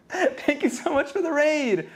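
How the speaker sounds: high, slow, excited shouted thanks; shouting. Real, not acted. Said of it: "I'm shouting, I'm screaming".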